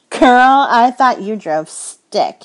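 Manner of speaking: high and loud, stretching out a playful jab; teasing coworker. Acted. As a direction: coworker Charlie ribbing the narrator, high, loud and slow. Charlie teasing, voiced higher.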